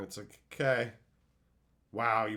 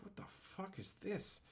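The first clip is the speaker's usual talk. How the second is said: whispered disgust; 7 semitones higher, 16 dB quieter than usual. Retold or imitated. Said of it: "you're like".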